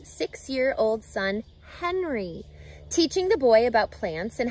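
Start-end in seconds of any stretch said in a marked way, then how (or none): none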